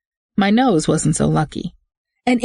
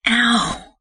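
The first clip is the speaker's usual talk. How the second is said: sharp high yelp of pain; 7 semitones higher than usual. A narrator's reading. Woman thrown in truck yelps in pain. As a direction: cry out as you hit the floor. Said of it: "I groaned".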